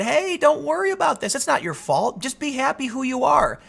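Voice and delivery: high, sing-song mock comfort; a hint of sugary reassurance. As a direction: parody a soothing ad voice. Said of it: "they said".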